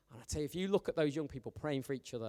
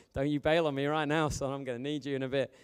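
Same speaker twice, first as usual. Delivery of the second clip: louder, teasing warning to a friend; playful call-out; 8 dB louder than usual. Real, not acted. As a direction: rib him in front of everyone. Teasing Clarkie from pulpit.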